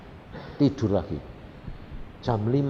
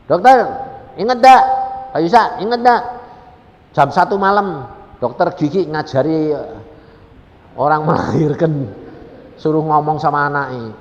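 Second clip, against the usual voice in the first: high, loud call to someone remembered; loud excited greeting; 4 semitones higher, 10 dB louder than usual. Retold or imitated. Mother reminding the doctor about that night, loud excited greeting. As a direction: hail the doctor loudly, easing down gradually.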